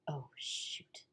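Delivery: hushed whisper of dread; whispered dawning worry. Retold or imitated. Daughter doubting her acceptance, whispering to herself.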